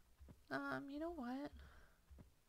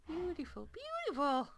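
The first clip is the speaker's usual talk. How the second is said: delighted exclaim; 7 semitones higher, 5 dB louder than usual. Real, not acted. Admiring the cleared land.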